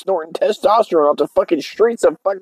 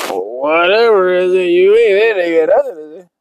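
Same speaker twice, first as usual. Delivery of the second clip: dismissive homie; 3 semitones higher, 3 dB louder than usual. Acted. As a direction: stretch it into a mocking lilt. Acts dismissive Chicano homie.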